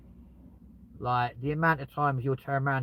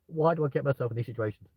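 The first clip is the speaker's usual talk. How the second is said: normal talking voice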